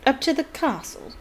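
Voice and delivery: smooth, mocking question; silky. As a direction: purr it with mock politeness. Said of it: "said Snape silkily".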